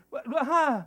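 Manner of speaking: high, loud stammering confusion; mock bewildered follower. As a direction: play a lost, baffled seeker. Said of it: "I've had that conversation".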